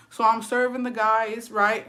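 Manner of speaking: usual speaking voice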